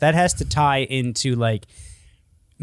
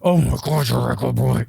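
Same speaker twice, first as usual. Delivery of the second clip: growled doggy praise in the usual register; growling pet talk; 2 semitones lower than usual. Shown as a demonstration. Man demonstrating acceptable dog talk, playful growl. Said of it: "if you're growling".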